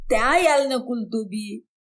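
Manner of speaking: teasing with a put-on lisp, lightly done; mocking lisp. Brother-in-law mocks husband with a childish lisp. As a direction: lisp the words to mock him. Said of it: "तू क्यों तुतलाने लगा अभी?".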